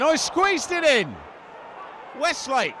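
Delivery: thrilled shout as it goes in; excited goal call. Real, not acted. Goal call in live commentary.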